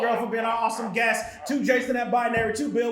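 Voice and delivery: ordinary delivery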